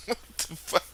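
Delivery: breathy whisper of disbelief. Real, not acted. Comedian whispering to the crowd in disbelief. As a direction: hush it like you can't believe it.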